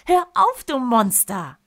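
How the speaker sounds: high, loud giggling yell; laughing shout. Acted. Boy being tickled shouts at uncle, loud and laughing. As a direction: shriek it while being tickled. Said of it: "schnappt vor Lachen nach Luft und ruft".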